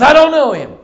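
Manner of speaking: loud, high shouted denial; scared man shouting to disown a friend; panicked denial, acted. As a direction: blurt it out at full volume. Peter acted scared, denying Jesus.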